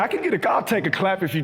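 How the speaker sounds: ordinary talk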